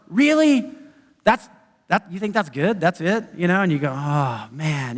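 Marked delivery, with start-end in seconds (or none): 0.1-3.2 a touch of a dismissive sneer; scornful critic. Acted. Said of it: "they say something about your work". 4.0-5.0 lower, softer deflated sigh of words; dejected. Acted. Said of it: "you just kinda get down".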